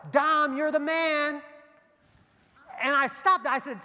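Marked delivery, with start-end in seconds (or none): 0.1-1.4 slow, drawn-out call, louder and higher; retold yell. Passing truck driver hails the barber, loud slow call. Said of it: "the guy yells out the window".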